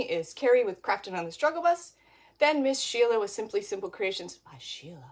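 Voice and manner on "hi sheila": much softer and lower, almost breathed out; odd hushed greeting. Pretend. Host greeting a guest crafter in an odd hushed low voice. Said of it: "I don't know why I gotta say it like that".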